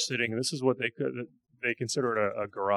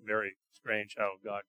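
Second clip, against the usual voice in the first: usual speaking voice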